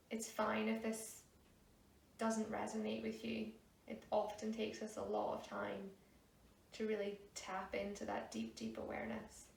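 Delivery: soft, hushed meditation guidance; guided meditation. Real, not acted. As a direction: ease them into stillness, softly, with long pauses. Leads a meditation.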